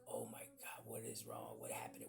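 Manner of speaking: low, whispered retold worry; hushed panic. Kid in class wondering what went wrong, hushed panic. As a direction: whisper it like a kid who fears trouble.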